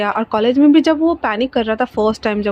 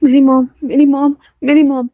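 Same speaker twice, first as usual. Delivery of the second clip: crying; 3 dB louder than usual. A narrator's reading. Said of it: "वो रोते हुए कहता है".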